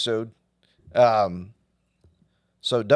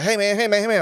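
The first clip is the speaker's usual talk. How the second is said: high, louder street call; 9 semitones higher, 4 dB louder, much faster than usual. Retold or imitated. Homeless man calling to a passerby, mock call. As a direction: repeat it to get his attention.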